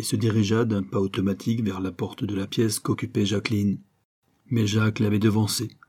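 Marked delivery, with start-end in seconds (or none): none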